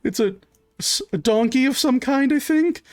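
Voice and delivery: high, halting answer rising at the end; hesitant suspect. Acted. Suspect answering the fort guard's question, high and hesitant. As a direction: guess in a high, unsure voice.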